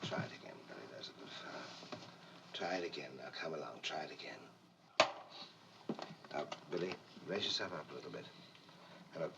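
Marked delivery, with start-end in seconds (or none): 0.0-8.2 quiet coaching. Real, not acted. Director coaching child softly.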